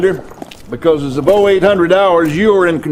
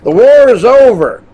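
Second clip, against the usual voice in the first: emphatic proclamation; 5 semitones higher, 5 dB louder than usual. Acted. General proclaims the war is over.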